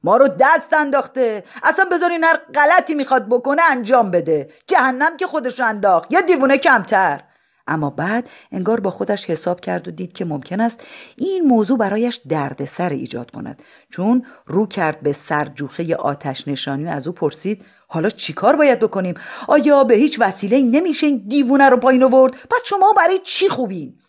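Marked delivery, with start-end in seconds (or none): 0.0-7.2 angry sheriff. Acted. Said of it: "سخت عصبانی شده بود". 17.9-24.0 fast, high exasperated demand; frantic sheriff. Acted.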